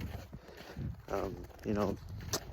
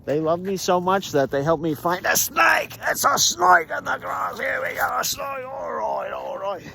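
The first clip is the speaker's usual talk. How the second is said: high excited shouting about a snake; 8 semitones higher than usual. Real, not acted. Walker shouting excitedly at a snake in the grass. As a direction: yell it out, thrilled by the find.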